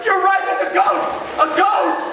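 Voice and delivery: high, loud mob shriek; panicked yell. Acted. Other disciples joining the ghost alarm, high panicked shouting. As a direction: pile on the panic.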